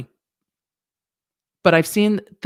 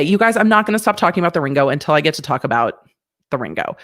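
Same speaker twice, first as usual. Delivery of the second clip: ordinary talk, nothing marked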